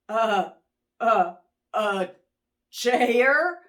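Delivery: nervous halting stammer. Acted. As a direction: stumble over the words. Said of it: "Turkey stammered".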